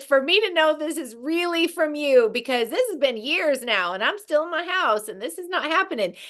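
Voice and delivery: high, defiant complaint to God; high attitude-filled complaint to God. Retold or imitated. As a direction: demand proof, put out. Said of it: "Total attitude".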